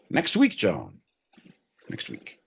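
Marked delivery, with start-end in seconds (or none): 1.9-2.4 breathy aside to himself; muttered repeat. Acted. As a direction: drop to a near whisper on the repeat. Repeats punchline under breath.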